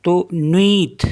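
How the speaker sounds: नुईत with ui as a quick wi glide; teacher sounding नुईत slowly; French pronunciation. Shown as a demonstration. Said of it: "यू आई को वी पढ़ते".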